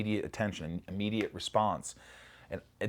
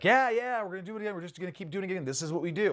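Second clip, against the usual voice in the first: high breezy yeah yeah, then easy; imitating the crew brushing it off; crew reassuring; 11 semitones higher, 3 dB louder, faster than usual. Film crew reassuring a nervous actor, light high voice. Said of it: "they're like".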